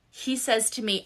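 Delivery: ordinary talk, nothing marked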